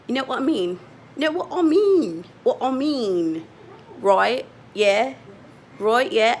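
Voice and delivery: amateur mock British accent; bad British accent. Shown as a demonstration. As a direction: try on a British accent, badly. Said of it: "I usually do a better British accent".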